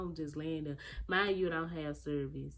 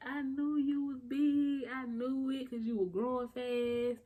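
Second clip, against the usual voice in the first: high, loud, drawn-out exclaiming; mock excited outburst; retold excited mom; 10 semitones higher, 4 dB louder, slower than usual. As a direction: imitate a thrilled mom crowing, slowly and pitched up. His mama reacts to the pictures.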